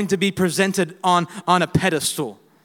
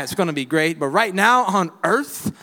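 ordinary talk, nothing marked

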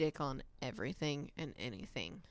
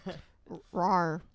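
mock growling rawr, louder; monster roar; 7 dB louder than usual. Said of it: "I can do it too".

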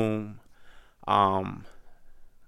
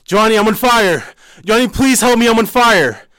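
high, loud scream for help; terrified scream, much higher and louder; panicked screaming; 16 dB louder than usual. Retold or imitated. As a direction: shriek for Johnny while burning. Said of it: "And then I yelled".

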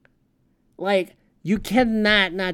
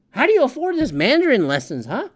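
ordinary talk

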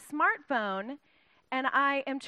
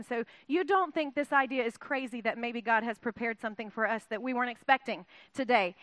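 ordinary delivery

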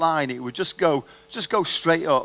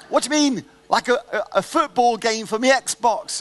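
put-on high, pushy kid voice bargaining; high child voice cheekily bargaining for a game from dad; cheeky bargaining son; 8 semitones higher, 5 dB louder than usual. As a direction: squeak it out like a kid haggling.

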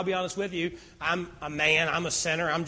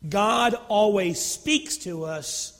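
usual speaking voice

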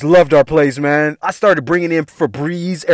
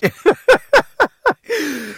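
high, long cackle; helpless high-pitched laughter; real laugh; 12 semitones higher than usual. Real, not acted. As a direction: burst out laughing after the punchline. Cracks up at his punchline.

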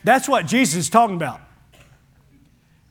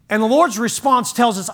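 usual speaking voice

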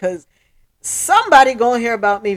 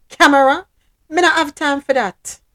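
Jamaican patois; 6 semitones higher, 6 dB louder than usual. Acted. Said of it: "typical Jamaican".

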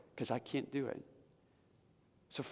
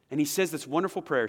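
normal talking voice

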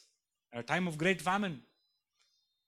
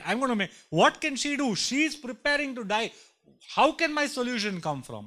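higher, louder mock disbelief; incredulous scoff; 7 semitones higher, 5 dB louder than usual. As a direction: scoff at the idea with a raised voice. Mocking own doubtful reaction.

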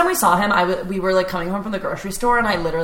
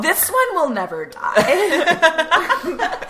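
spooky voice; 8 semitones higher, much slower than usual. Acted. Voicing the ghost character.

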